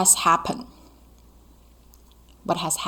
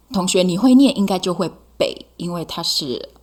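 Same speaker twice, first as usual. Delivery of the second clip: normal talking voice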